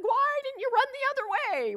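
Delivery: high, loud motherly exasperation, acted out; retold worried outcry, pitch shooting way up; alarmed outburst. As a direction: cry it out high like a panicked parent. Mother's alarmed first reaction, retold.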